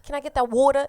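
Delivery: exaggerated New York vowels, teasing; mock New York accent. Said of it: "doing the New York accent".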